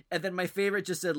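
ordinary talk

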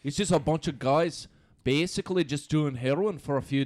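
lightly hammed-up Scottish accent. Describing Trainspotting to friends, in Scottish accent.

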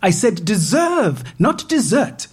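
deserve and dessert sharply set apart, high on dessert; stress on deserve. Acted. Woman correcting the AI's mishearing, stressing deserve. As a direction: spell out the difference impatiently.

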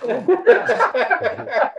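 slow, drawn out through laughter; laughing disbelief. Retold or imitated. Recalling giant teammates, slow laughing disbelief.

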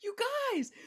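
wanting to shake the worried crowd, high excited plea; mock urgent plea. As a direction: shake them awake with amazement. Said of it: "I wanted to shake them and say".

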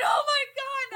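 very high, loud re-enacted wail; retold scream. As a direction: scream in mock horror, half laughing. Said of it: "And I'm fucking screaming".

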